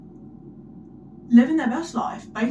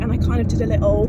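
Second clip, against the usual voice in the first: ordinary talk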